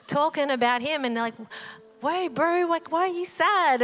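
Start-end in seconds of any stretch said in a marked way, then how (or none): 2.0-3.8 higher-pitched, playful mock puzzlement; teasing pretend ignorance; feigned innocent concern. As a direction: act clueless, voice lifting high. Acted question, Jesus playing dumb.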